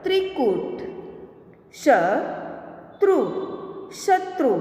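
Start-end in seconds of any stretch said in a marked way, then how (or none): 1.7-3.2 slow, each sound drawn out, rising at the end; slow drawn-out reading. Shown as a demonstration. Teacher slowly sounding out a word for students, drawn out. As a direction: stretch the word slowly for the class. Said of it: "आगे देखें".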